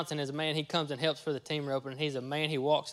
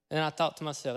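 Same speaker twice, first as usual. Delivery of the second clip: usual speaking voice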